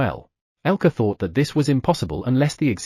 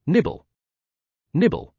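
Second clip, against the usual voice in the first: nibbling sounds; 6 semitones higher than usual. Acted. Gnawing the rope.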